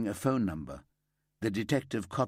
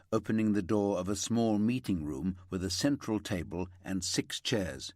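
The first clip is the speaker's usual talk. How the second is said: usual speaking voice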